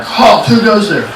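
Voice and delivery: high-pitched military call; guard's challenge. Retold or imitated. Guards at the gate challenge fleeing intruders, high call. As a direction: imitate a guard calling out a challenge.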